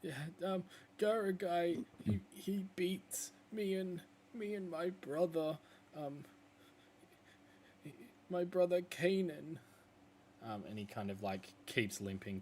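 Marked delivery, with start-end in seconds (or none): 0.0-9.6 high, timid self-introduction; shy wolf. Acted. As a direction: say his name shyly. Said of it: "and he's like".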